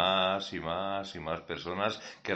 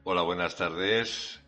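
ordinary delivery

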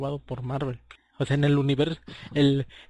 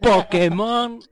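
dramatic announcement; 7 semitones higher, 9 dB louder, much slower than usual. Acted. Dramatic reveal of big news.

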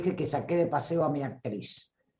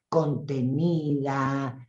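normal talking voice

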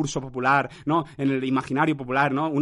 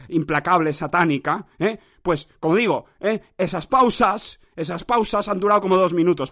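ordinary delivery

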